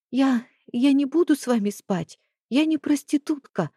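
put-on scared young woman's voice; frightened. Frightened girl refusing.

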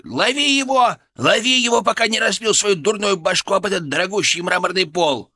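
high, loud, frantic yell; panicked yelling. A narrator's reading. Sidekick urging doctor to catch him, panicked yell. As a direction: shriek orders to catch him. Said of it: "завопил Фырк".

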